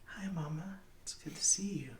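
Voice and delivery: put-on high-pitched child greeting his mama; little boy. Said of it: "human boy".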